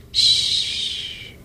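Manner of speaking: soft unvoiced shush; wind whisper. A narrator's reading. The wind answering the bunny, whispered shush. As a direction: hush like the wind. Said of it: "The wind whispered".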